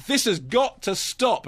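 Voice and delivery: slow, high mock bad acting; Gordon Ramsay. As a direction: drag out each word like terrible cameo acting. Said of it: "quite the worst cameo acting".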